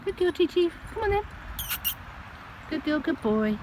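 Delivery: very high cooing pet voice; coaxing the cats. Real, not acted. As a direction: sweet-talk the cats out, high and sing-song.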